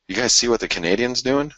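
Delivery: sharing a big market move, thrilled; excited, voice lifted high. Real, not acted.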